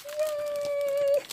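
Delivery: high delighted yay; happy squeal. Real, not acted. Opening the next gift with a joyful squeal. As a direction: squeal it with glee.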